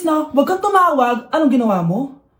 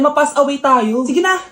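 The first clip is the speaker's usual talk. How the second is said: ordinary talk, nothing marked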